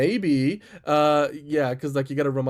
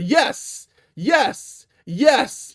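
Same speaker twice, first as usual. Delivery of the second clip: high excited cheering; excited yes; 10 semitones higher, 4 dB louder, much slower than usual. Acted. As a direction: exclaim each one, thrilled. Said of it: "'cause there's three exclamation marks".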